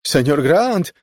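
high, loud call for help; repeated cry. A narrator's reading. Maid calling Senior Grant to the scene, crying out. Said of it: "powtórzyła".

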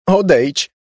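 high, loud terrified shout; panicked scream. Acted. Laura screams at her dead sister's voice, high and loud. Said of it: "krzyknęła ogarnięta paniką".

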